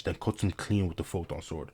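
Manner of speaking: usual speaking voice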